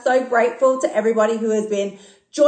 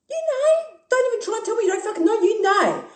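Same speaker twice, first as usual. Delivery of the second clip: mock disbelief; 11 semitones higher than usual. Coach calling out listeners who fake effort, high teasing voice. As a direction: pitch jumps way up, quick and knowing.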